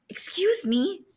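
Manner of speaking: small, high, soft stunned question; barely managed words. A narrator's reading. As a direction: squeak it out softly, taken aback. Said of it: "he managed".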